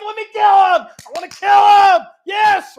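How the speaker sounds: high, shouted, giddy begging; excited kid. Retold or imitated. King begging Elisha to let him kill, shouting high and giddy. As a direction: shout it like an overexcited child. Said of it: "He's like ... He was all excited".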